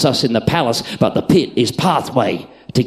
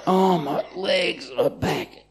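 put-on groaning teen; 6 semitones higher, 5 dB quieter, slower than usual.

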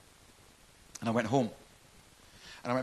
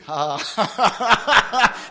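mock laugh of a stoned mate; imitated stoned laugh; 6 semitones higher, 7 dB louder than usual. Preacher mimicking his stoned mate John, stoned laughing. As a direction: laugh loud and high like he's stoned. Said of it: "He was stoned out his brains".

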